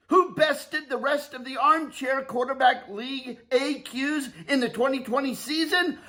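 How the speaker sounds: rising hype announcer build-up. Pretend. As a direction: build the crowd up before the call.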